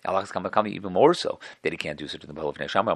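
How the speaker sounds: ordinary delivery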